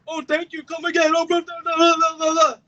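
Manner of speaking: high, sing-song mock of hallway bullies; mocking taunt. Speaker recalls bullies' hallway taunts, mocking high voice.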